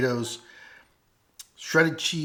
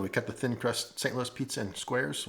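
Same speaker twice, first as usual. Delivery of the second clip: ordinary talk, nothing marked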